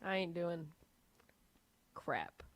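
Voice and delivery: low, quiet, grudging gutter guy; dropped-low deadpan gutter guy voice. Acted. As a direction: refuse like an unimpressed Southern tradesman. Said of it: "what I would say if I was a gutter guy".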